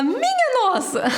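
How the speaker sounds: high, laughing "minha nossa"; mock amazement. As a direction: gasp it out like you can't believe it. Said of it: "Aí você fala".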